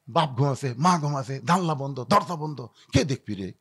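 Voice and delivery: hushed, low list in short bursts; furtive inner voice. Acted. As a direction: tick them off secretly under your breath. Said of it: "এখন এই যুবকেরা রাত্রে মনে করতেছে". (চাপা নিচু গলায় চুপিচুপি)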